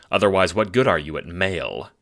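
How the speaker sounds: ordinary delivery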